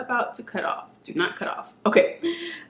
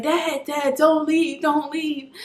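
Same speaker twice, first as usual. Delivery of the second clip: higher, lightly acted plea to a father; imitated young Simba; 7 semitones higher, slower than usual. Son pleading with his dead father in the clouds, imitated plea. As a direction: imitate a son begging his dad to stay. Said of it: "in the original, he was like".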